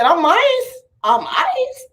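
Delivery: very high squeaky mouse. Retold or imitated. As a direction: squeak like Jerry. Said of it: "The mouse said".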